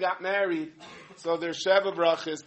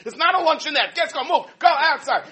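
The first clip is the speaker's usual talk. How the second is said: high, loud hurrying wife voice; impatient wife; 11 semitones higher, 9 dB louder than usual. Retold or imitated. Wife chases husband from the fridge, loud and high. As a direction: shoo him out, hammed-up impatience. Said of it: "He opens up the refrigerator".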